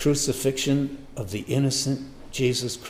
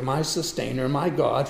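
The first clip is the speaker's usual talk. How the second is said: usual speaking voice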